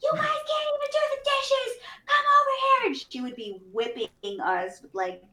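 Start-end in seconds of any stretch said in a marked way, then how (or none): 0.0-2.9 mom screaming. Retold or imitated. Mom exploding over dirty dishes, high shout. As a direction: scream at the kids.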